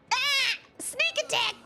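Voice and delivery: a sudden fright in a high squeal; startled shriek. Acted. Startled by game character.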